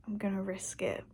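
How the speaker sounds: conspiratorial whisper. Real, not acted. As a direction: whisper it like a guilty secret. Daring herself to drink Sneak.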